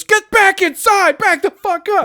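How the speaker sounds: high, loud barked order; full-voiced acted soldier shout; army guy shouting. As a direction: yell a panicked command at someone approaching. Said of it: "army guy just lifts a gun and goes".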